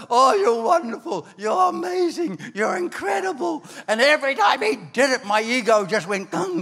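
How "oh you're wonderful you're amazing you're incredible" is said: high, shouting praise, piling it on; gushing flattery. Retold or imitated. First pastor flattering the young preacher, gushing high. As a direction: gush like a pastor showering compliments.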